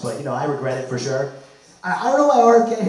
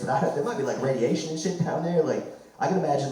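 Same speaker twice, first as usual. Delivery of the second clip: ordinary talk, nothing marked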